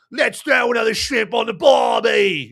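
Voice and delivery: loud, high mock Australian accent. Mocking the greedy fleet commander, loud Australian accent. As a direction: ham up an Aussie accent for the shrimp line. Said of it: "you know, accent".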